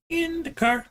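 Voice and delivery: very high squeaky ending; squeaky baby talk. Pretend. Teasing the cat about the car, ending in a very high squeak. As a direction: jump up into a squeak.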